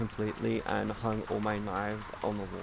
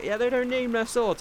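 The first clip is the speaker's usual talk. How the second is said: mocking imitation; 11 semitones higher, 3 dB louder than usual. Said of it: "you're just sitting there going like".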